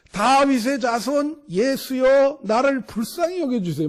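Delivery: half-voiced imitation of a beggar crying out; retold desperate cry. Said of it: "냅다 소리를 지르는 겁니다".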